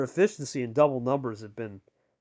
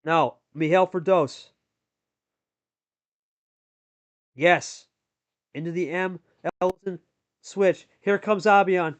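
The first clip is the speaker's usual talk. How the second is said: brisk, raised commentator call; live play-by-play; 5 semitones higher, 5 dB louder than usual. Acted. Commentator calling the match for viewers, lively play-by-play. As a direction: call every card as it lands.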